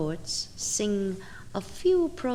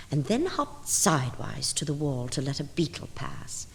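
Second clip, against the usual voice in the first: plain reading voice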